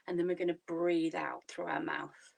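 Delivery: normal talking voice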